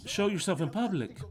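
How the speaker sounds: normal talking voice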